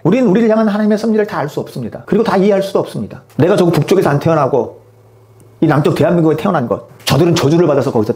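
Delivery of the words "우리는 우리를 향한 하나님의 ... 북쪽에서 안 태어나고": ordinary talk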